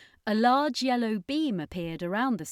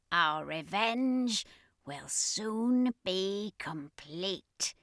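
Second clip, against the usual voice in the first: cruel commander. Acted. Commander rebuking the underling, cruel slow menace. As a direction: threaten with slow relish.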